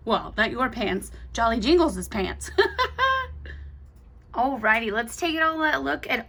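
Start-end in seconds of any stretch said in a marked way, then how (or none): 2.5-3.7 high, light giggle at her own joke. Real, not acted. Laughing at her own joke about the snowman's pants, high giggle. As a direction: let a bright little laugh slip out.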